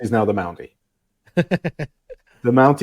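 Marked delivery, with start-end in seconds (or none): 1.4-2.8 slow, drawn-out ring announcer call; announcer intro. Pretend. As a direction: stretch it like a wrestling entrance. Naming co-host the Mountie.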